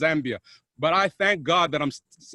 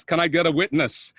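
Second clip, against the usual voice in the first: usual speaking voice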